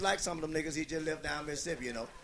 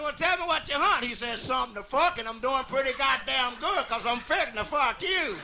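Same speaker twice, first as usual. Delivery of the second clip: loud joking voice; 6 semitones higher, 6 dB louder than usual. Acted. Quoted exchange with hillbilly.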